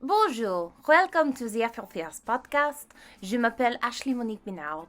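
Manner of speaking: hammed-up bad French accent; terrible French accent. Host reintroduces her podcast in a terrible French accent. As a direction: greet listeners in a badly faked French accent. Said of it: "My terrible French accent".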